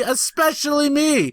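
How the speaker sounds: whiny. Retold or imitated. Retelling, scolding drunk girlfriend.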